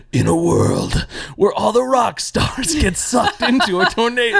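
lightly voiced trailer announcer, slowed down; movie trailer voice. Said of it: "his concept of it was".

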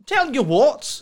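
hammed-up Australian drawl; mock Aussie accent. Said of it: "weird accent".

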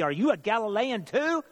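high-pitched snarled challenge; snarling. A narrator's reading. Council members turn on Nicodemus for speaking up, snarling. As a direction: throw the question back as a rising, accusing jab. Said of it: "They turned and snarled at me".

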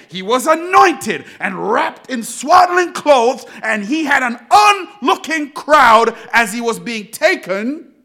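loud, forceful preaching; vaaz coşkusu. Acted. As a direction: preach it out to the congregation, punching key words.

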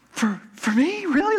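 high, halting imitation of stunned gratitude; touched disbelief. Overwhelmed student receiving free candy, imitated in high disbelief. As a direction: play a student stunned by the gift. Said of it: "students were on the verge of tears".

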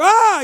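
sudden high, loud scream; startled, high-pitched yell; frightened scream. Retold or imitated. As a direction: scream out in alarm, reenacting a fright. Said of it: "I screamed 'cause I was scared".

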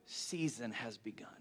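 slow, whispered revelation; hushed awe. Retold or imitated. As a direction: let it sink into a whisper. Weighty message of Jesus quoted.

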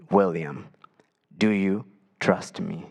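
lightly voiced God, slow and low. The only thing he heard, retold as God's low voice. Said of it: "hear this voice".

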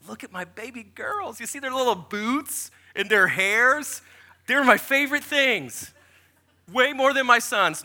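lightly voiced gushing; a hint of cutesy adoration; doting post voice. Acts out daughter's proud post.

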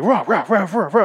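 high, loud repeated "rah" bursts; freaky machine noise. Retold or imitated. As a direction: imitate a robot going haywire. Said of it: "it starts going freaky".

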